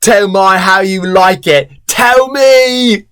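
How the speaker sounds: put-on voice, rising in pitch; Tom. A narrator's reading. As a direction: climb to an insistent high demand. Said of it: "Then he continued".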